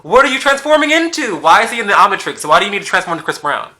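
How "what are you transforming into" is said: sneering high-pitched quote of the meme; mocking high meme voice. Vlogger mocking the men who post the meme, high and sneering. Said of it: "made him into a meme".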